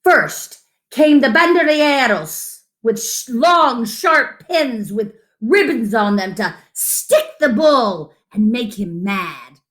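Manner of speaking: plain reading voice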